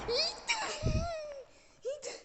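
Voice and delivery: high loud shriek; startled shriek. Real, not acted. Scared by the door, high shriek.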